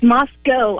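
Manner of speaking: Moscow said as MOS-koh; local pronunciation of Moscow. Shown as a demonstration. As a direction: end Moscow on a long O, like locals. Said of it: "that's how we pronounce it here".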